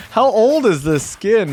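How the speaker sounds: loud, high, drawn-out incredulous question; stunned, stretched-out exclamation; amused disbelief. Real, not acted. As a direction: blurt it out slowly in astonishment. Astonished by crazy old skin.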